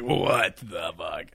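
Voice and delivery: low, slow, soft mock bewilderment; stunned disbelief. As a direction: drag it out quietly, as if dumbfounded. Imagining Kyle's stunned reaction.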